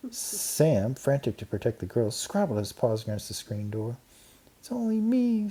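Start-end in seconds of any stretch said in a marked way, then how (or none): 4.6-5.5 very high squeaky mouse voice. A narrator's reading. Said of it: "squeaked Ralph".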